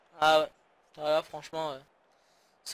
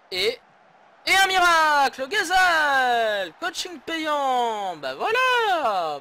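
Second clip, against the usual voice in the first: much higher, loud, drawn-out goal cry; excited shout; 10 semitones higher, 9 dB louder, much slower than usual. Real, not acted. Manager celebrating the sub's goal, loud high shout. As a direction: yell it like the goal saved the match. Goal after his substitution.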